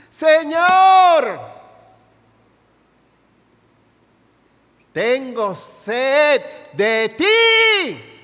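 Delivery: clamor a gritos. Acted. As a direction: cry out to the Lord with longing. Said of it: "¿Hace cuánto que no gritas así?". (clamor fuerte y lento, de súplica desesperada)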